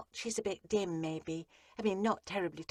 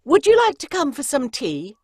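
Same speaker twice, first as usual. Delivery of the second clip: suddenly much higher and louder character voice; overacted dialogue; 9 semitones higher, 13 dB louder than usual. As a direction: jump out of the cosy narration into a hammy voice. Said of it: "And then".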